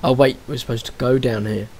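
ordinary delivery

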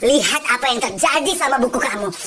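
ordinary talk

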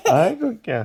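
bursting into laughter; laughing. Real, not acted. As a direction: laugh along while you talk. Laughing at the baby.

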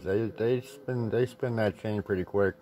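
normal talking voice

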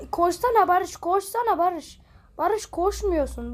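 loud, high urging shout; frustrated yelling. Real, not acted. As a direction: scream at him to run. Urging player to run.

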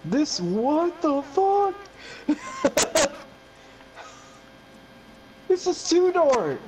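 high, slow, panicked outcry with a nervous laugh; scared stiff in the dark, voice shooting up; freaked-out panic. Real, not acted. Player panicking to his friend in the dark, high freaked-out cry.

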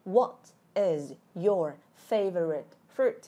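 slow, separated words; word-by-word model. Shown as a demonstration. Teacher repeating the question for viewers to answer, word-by-word. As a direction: space out each word for learners to follow.

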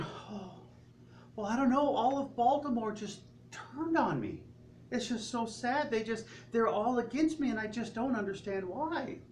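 high-pitched poor me voice; mock whiny victim. Restaurant owner complaining to the chef in a high poor me voice.

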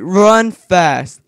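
normal talking voice

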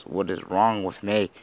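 normal talking voice

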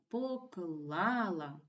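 "поклала" very slowly, the stressed "кла" drawn out; stress on second syllable. Shown as a demonstration. Teacher shows pupils second-syllable stress, "поклала" slow with a long "кла". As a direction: stretch and hit "кла" in "поклала". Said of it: "на другому складі".

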